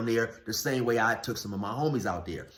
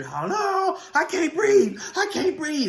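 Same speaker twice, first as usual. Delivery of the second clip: mock scream in a very high voice; high, put-on squealing plea; retold screaming; 4 dB louder, slower than usual. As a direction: imitate a woman crying out, pitched way up. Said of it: "and she's screaming".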